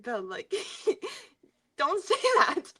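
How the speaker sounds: imitating a mom's plea through giggles; amused imitation of a dismayed mom; laughing mock reproach.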